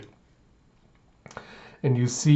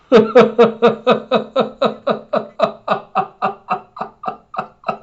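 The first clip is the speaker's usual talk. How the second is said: long, high, loud laugh that tapers off; real laughing fit; 10 semitones higher, 5 dB louder than usual. Real, not acted. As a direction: crack up in rapid, even pulses. Cracking up at the scene.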